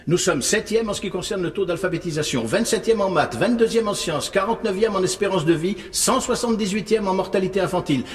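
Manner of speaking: high, insistent volley of figures; scathing tirade. Acted. Panelist lecturing a student on the country's rankings, scathing tirade. Berates student with damning statistics.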